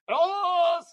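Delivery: high loud Elvis cry; Elvis shout. Acted. Dog puppet bursting out with his Elvis bit, shouted. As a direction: belt the name like an Elvis fan. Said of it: "impersonation of [name]".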